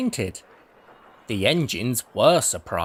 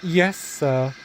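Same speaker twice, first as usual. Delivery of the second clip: nervous; 3 semitones higher than usual. A narrator's reading. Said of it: "said Thomas nervously".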